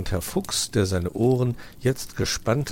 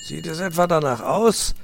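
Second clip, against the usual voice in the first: high, sharp grumpy fox snap; hissing snap; 9 semitones higher, 5 dB louder than usual. Acted. Uncle fox snaps at nephew, mock hiss. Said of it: "fauchte Herr Fuchs ihn an".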